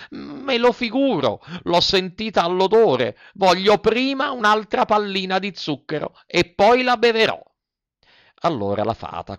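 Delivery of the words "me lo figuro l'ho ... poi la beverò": put-on high, stubborn puppet boy; whiny Pinocchio. Puppet demands more sugar before drinking, whining stubbornly. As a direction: insist in a high childish whine.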